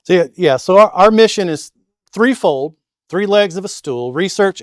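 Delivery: loud, high coach's voice. Real, not acted. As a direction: project it like a basketball coach. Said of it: "I'll just use my coach's voice".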